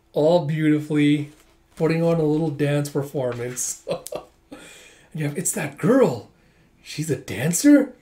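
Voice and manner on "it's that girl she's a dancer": acted astonishment, voice swooping up on the key word; surprised recognition. Onlooker spots the girl dancing on stage, surprised. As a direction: play a startled onlooker. Voicing surprised character in manga.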